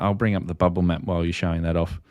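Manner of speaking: usual speaking voice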